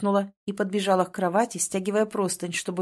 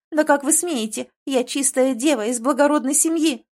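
high, louder offended outcry; indignant; 5 semitones higher, 5 dB louder than usual. A narrator's reading. Girl defending her noble honour, indignant. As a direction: protest with wounded dignity.

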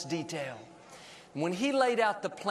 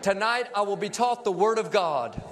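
leading a congregational confession. Real, not acted. Preacher leads the church in a Bible confession, declaimed phrase by phrase. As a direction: declaim it in short phrases, pausing for repeats.

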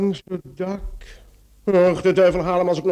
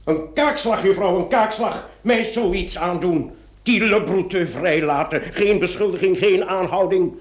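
louder, sputtering indignation; indignant outburst; 3 semitones higher, 5 dB louder than usual. Acted. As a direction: vent your wounded pride at her. Commissaris furious after release.

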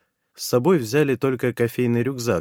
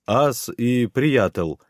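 slow halting made-up Bulgarian; broken Russian-Bulgarian. Acted. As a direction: piece the words together slowly. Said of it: "изрёк я, видимо, на каком-то своём языке".

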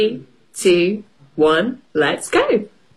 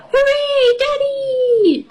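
excited shout; slower than usual. Acted. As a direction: cheer like a thrilled little girl. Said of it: "shouts Peppa".

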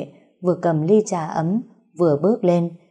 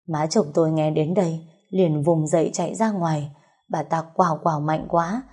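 usual speaking voice

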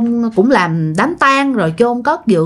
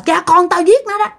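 possessed man's voice; 5 semitones higher than usual. Retold or imitated. Possessed neighbor taunting the mourners, raised gloating voice. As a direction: gloat like a spirit owning the murder. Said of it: "Cái bà mới nói lại là".

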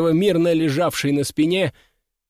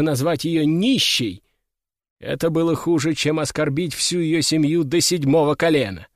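usual speaking voice

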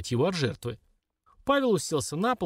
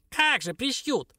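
laughing mockingly; 11 semitones higher, slower than usual. A narrator's reading. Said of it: "захохотал Сараш".

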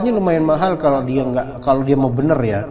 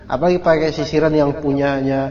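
ordinary talk, nothing marked

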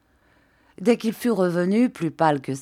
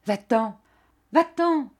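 high-pitched order, rising on the repeat; crying out; 6 semitones higher than usual. A narrator's reading. Jeanne dismissing her maid, crying out. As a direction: cry it out higher the second time. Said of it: "Elle cria".

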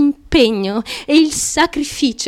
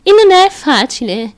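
usual speaking voice

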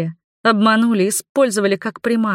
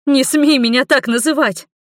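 hissed rebuke; 7 semitones higher, 3 dB louder than usual. A narrator's reading. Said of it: "прошипела я".

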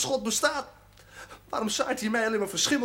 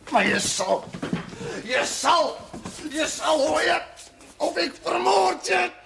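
rising threat; 8 semitones higher, slower than usual. Acted. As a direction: climb step by step into a death threat. Threatens the young priest.

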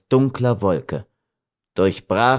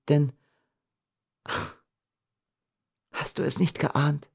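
higher-pitched heroine confessing her fate; tender, sorrowful Beatrice; 7 semitones higher than usual. A narrator's reading. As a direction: confide in a high, wistful voice.

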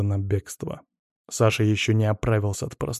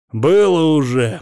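loud, drawn-out mockery; mocking; 4 semitones higher, 8 dB louder, slower than usual. A narrator's reading. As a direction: jeer slowly, as if seen it all. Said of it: "насмешливо сказал Сашин". (насмешливо, громко и протяжно)

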